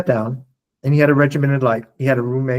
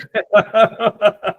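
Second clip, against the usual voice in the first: burst of laughter; 7 semitones higher, 5 dB louder than usual. Real, not acted. Laughs at the guide's joke.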